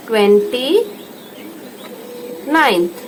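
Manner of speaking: ट्वेंटी, a pause, then ninth; twenty ninth spoken in two halves; split for dictation. Shown as a demonstration. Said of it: "अब twenty ninth".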